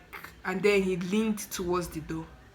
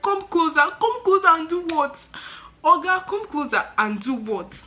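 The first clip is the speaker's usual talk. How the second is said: high, loud indignant echo; incredulous protest; 10 semitones higher, 8 dB louder than usual. Retold or imitated. Visitor answering the attendant's call to come closer, incredulous. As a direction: throw the order back, voice pitched up.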